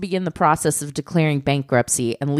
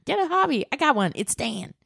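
mocking doting parent; 9 semitones higher, 5 dB quieter than usual.

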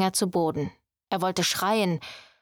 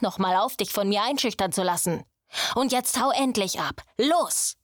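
higher, driving orders to get out; harsh command; 4 semitones higher than usual. Acted. Luca chases Quinn out, harsh and commanding. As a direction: bark him out of the room.

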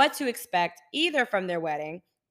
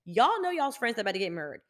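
normal talking voice

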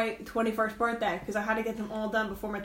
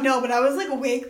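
usual speaking voice

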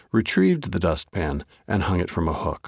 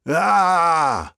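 sudden high loud scream of fright; startled scream; 11 semitones higher, 7 dB louder than usual. A narrator's reading. Baker startled by the slammed door, shrieking. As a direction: yelp out in shock as the door slams. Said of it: "screamed Reb Stein".

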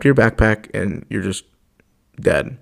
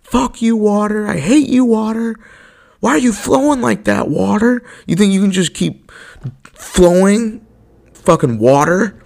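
much higher, mock-angry yell at a stream; yelling at the water; 11 semitones higher, 3 dB louder than usual. People yelling at a flowing stream, mock angry yell. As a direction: rant at the water like a pointless angry heckler. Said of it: "a group of people yelling at the water".

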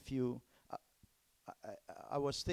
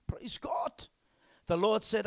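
usual speaking voice

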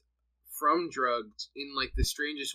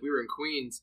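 ordinary talk, nothing marked

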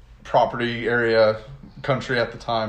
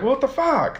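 much higher squeal of disbelief; mock shocked outrage; 14 semitones higher than usual. Germans learning of the attack, a much higher squeal of disbelief. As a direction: act stunned and indignant.

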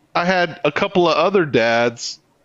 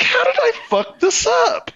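mock anguish. Retells despair.

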